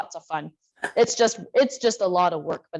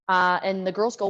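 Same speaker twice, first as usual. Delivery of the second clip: ordinary talk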